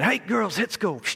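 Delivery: high-pitched call to sled dogs; calling a command. Retold or imitated. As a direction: call the team to get moving. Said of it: "I give my leaders the command".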